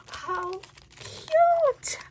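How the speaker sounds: high, loud squeal on cute; squealing delight. Real, not acted. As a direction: gasp then squeal with delight. Excited unboxing reveal.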